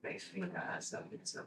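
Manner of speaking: breathy whisper to herself, quick; half-voiced mutter while working; whispered aside. Real, not acted. Doing her makeup, she whispers to herself.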